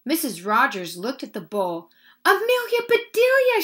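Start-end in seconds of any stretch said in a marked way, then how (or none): 2.2-3.6 high, loud cry of dismay. A narrator's reading. Missus Rogers sees the bowl of flowers, crying out. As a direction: cry out the name in alarm. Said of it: "she cried".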